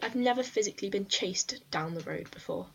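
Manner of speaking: blurting it out as if still rattled by the chase; worked-up outburst. Retold or imitated. Telling her friend about being chased, loud shaken outburst.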